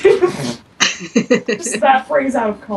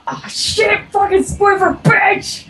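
annoyed outburst; 7 semitones higher than usual. Real, not acted. Cursing at the game enemy.

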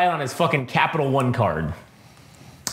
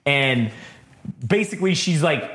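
ordinary delivery

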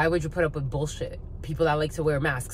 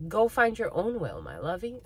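ordinary talk, nothing marked

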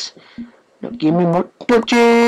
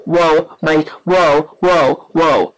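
normal talking voice

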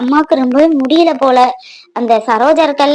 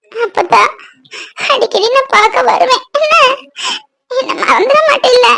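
high, crying voice; tearful pleading; 8 semitones higher than usual. Acted. Granddaughter asking grandmother not to forget her, tearful pleading. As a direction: plead through tears in a raised pitch. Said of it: "குமாரி, அழாத தாயி".

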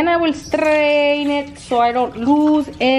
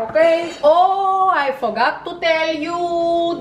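ordinary talk, nothing marked

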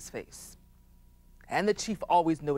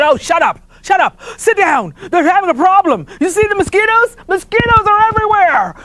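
angry chief yelling; 13 semitones higher, 15 dB louder than usual. Acted. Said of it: "he said".

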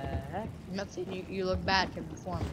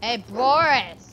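slow, loud call across the room; 3 semitones higher, 9 dB louder, much slower than usual. Real, not acted. Calling out to Boris in the game, loud and slow. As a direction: call Boris over loudly.

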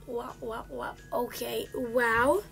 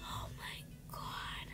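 breathy frightened whisper; 13 dB quieter than usual. Real, not acted. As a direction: whisper it in awe. Whispers after scare.

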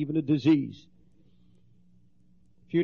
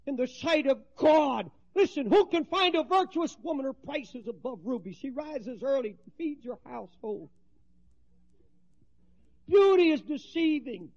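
preaching at full force; 9 semitones higher than usual. Acted. Preacher exhorting the women in the congregation, full force.

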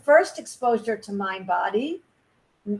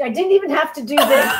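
excited quote; 5 semitones higher, 7 dB louder than usual. Retold or imitated. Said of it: "she said she didn't even have to do".

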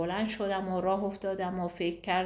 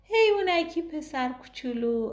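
lightly voiced little girl; a hint of a sad small girl; child voice; 9 semitones higher, 4 dB louder than usual. A narrator's reading. Girl pitying the book's little boy, lightly voiced child.

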